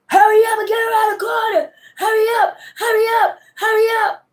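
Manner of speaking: high, urgent yelled commands; imitating a cop yelling over the loudspeaker; mock loudspeaker yelling. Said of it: "Calling shit on the loudspeaker".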